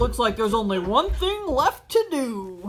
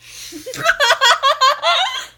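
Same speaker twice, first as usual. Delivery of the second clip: high cackling laugh; 12 semitones higher, 8 dB louder than usual. Acted. SpongeBob cracking up at hiccup.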